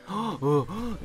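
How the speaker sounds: high-pitched gasped "oh"; mock onlookers' gasps. Staff eyeing up an argument, mock high gasps. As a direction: imitate a nosy crowd gasping. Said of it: "were like".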